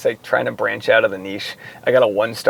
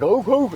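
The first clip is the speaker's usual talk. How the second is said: high, whiny mock dog whimper; imitating a dog's little whine; dog whining; 9 semitones higher than usual. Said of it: "so he'll be like".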